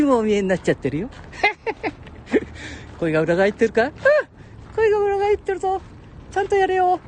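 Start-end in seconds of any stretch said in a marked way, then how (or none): 1.1-2.9 high breathy laugh; falsetto laugh. Real, not acted. Laugh in cracked voice. 4.7-7.0 cracked into falsetto; voice cracking. Real, not acted.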